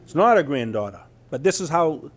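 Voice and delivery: normal talking voice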